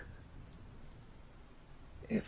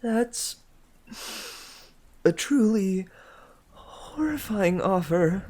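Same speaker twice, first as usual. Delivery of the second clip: higher, appalled reply; disgusted; 9 semitones higher than usual. A narrator's reading. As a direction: recoil at the offer.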